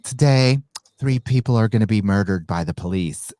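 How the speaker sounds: mocking Oscars speech. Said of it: "what did that guy say".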